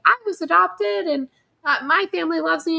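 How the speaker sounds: high, bright imitation of a grateful adopted kid; a hint of a sincere young fan, pitched high; earnest kid quote. A fan imitating a kid talking to the screen, pitched high and earnest. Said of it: "be like".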